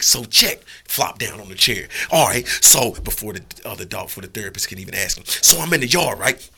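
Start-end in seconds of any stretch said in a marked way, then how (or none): 0.0-2.9 loud, eager dog plopping down to talk; cocky dog. Acted. Dog flops into the therapist dog's chair, loud and eager. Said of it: "the dog would have been like". 5.3-6.5 higher and much louder, a yelling worked-up dog; excited dog. Acted. Dog vents to a fellow dog therapist, shouting high. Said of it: "the dog would have been like".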